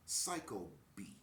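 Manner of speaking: slow, deliberate spelling out of the nickname; slow reveal. Shown as a demonstration. Worker explaining the coworker's nickname, slow and deliberate. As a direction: spell out the meaning slowly for effect. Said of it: "What does that mean?".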